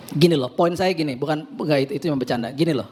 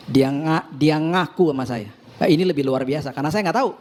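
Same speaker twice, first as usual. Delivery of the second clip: normal talking voice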